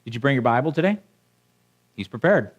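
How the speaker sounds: ordinary delivery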